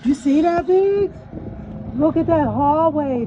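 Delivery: excited loud exclaiming. Real, not acted. Amazed at the creepy hallway.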